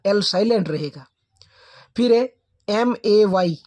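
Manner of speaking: normal talking voice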